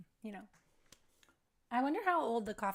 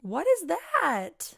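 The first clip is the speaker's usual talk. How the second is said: shocked; 8 semitones higher, 8 dB louder, much slower than usual. Real, not acted. Shocked at strange image.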